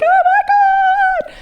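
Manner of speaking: high, loud, slow Oh my God; best friend bursting into tears at the song; overjoyed cry. Retold or imitated. Best friend crying out high and loud, in tears. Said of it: "She's like".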